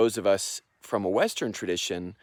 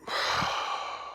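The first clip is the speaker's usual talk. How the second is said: long soft voiceless sigh; demonstrated exhale; 6 dB quieter than usual. Shown as a demonstration. Teacher showing breathing in and out, long soft exhale. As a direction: breathe out slowly and audibly. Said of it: "I'm gonna breathe in".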